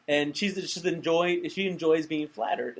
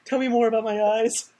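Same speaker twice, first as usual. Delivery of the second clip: high, giggly mock Whoopi line; laughing; 6 semitones higher than usual. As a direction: laugh the words out.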